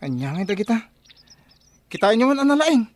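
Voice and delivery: high, loud call to come and look; excited call. Acted. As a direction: shout it out, thrilled by the find. Digger calls the others over. (naragsak nga ayab kadagiti kaduana)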